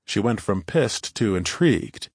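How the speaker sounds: usual speaking voice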